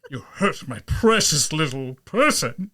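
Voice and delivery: slow, gritty put-on character voice; deep gritty voice. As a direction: draw it out in a hammed-up gritty voice. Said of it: "really deep, gritty voice".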